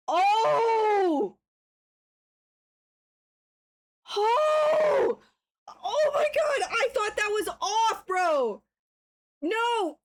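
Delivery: high wailed outcry, easing off; real shocked yelling. Real, not acted. Streamer who thought that was off, high shocked yelling. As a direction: cry out high in dismay, then come down. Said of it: "I'm sorry for yelling".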